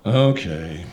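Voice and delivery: very low resigned sigh-word. Acted. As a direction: give in heavily. Official giving in.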